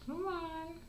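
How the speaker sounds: high, sing-song coaxing to a kitten; high pet-calling voice. Real, not acted. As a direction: call the kitten out sweetly.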